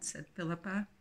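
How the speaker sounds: ordinary delivery, nothing marked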